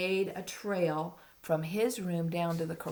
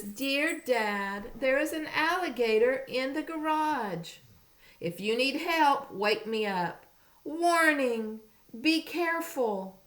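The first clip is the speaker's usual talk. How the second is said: put-on higher childlike note reading; 7 semitones higher, 4 dB louder than usual. Reading the boy's note aloud.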